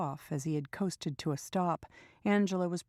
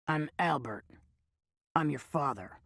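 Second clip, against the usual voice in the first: put-on raspy old man; 2 semitones lower than usual. Old man reveals himself.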